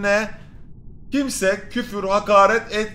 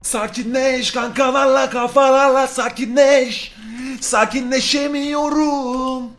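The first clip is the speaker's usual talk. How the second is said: yavaş telkin; 6 semitones higher, much slower than usual. Pretend. Kendine sakinleşme telkini veriyor.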